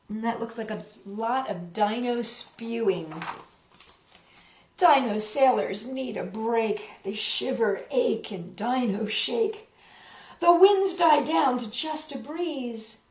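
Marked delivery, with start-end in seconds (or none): none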